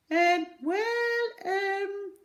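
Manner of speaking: high, loud sing-song hesitation; a bad liar stalling for time; loud hummed um. A narrator's reading.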